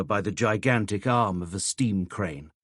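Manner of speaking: ordinary delivery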